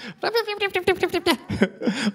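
light embarrassed laugh; a quick high-pitched laugh. Real, not acted. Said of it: "Okay, I'll slow down again then".

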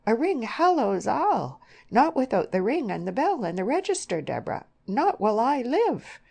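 loud, high, insistent protest; horrified. A narrator's reading. Mother insisting on a wedding ring, horrified. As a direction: forbid it in horrified alarm.

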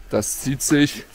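ordinary talk

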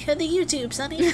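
put-on high, drawling old granny. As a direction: play a sweet old granny. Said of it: "which I sound like an old granny".

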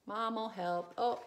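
ordinary delivery

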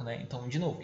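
ordinary delivery

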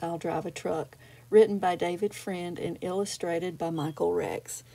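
plain reading voice

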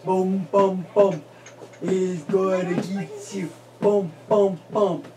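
low, slow lumbering bear; low stomping bear sounds. Pretend. Parent to baby acting the bear coming, low lumbering growl.

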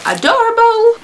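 high squeal, far above her normal voice; squealing adorable. Real, not acted. Opening a cute clutch on camera, high delighted squeal. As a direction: gush it like you just saw a puppy.

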